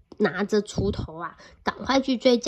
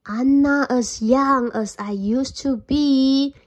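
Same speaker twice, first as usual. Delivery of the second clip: slow, put-on frail voice; a cunning fox playing feeble; sly old fox; 3 semitones higher, 4 dB louder, slower than usual. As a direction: fake being old and deaf. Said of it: "pretended that he couldn't hear well".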